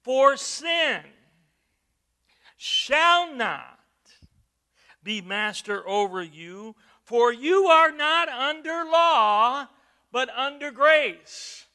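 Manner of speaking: thundering scripture. Acted. Preacher proclaiming grace over law, thunderous. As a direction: let the verse ring out to the back row.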